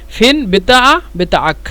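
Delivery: teacher models the Arabic; Arabic demo. Shown as a demonstration. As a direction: model ফেন বেতা আ then বেতা আক for learners. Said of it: "একসাথে যদি বলে".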